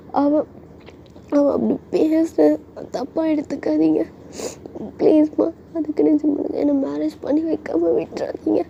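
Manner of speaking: high, slow, tearful pleading; whimpering daughter's plea, drawn out; acted crying. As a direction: beg dad in a high, weepy girl voice. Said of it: "அப்படின்னு சொல்லிட்டு நீங்களும் வந்து அழுகுறீங்க".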